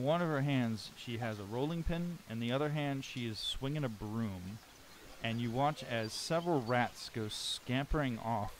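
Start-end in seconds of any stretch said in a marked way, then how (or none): none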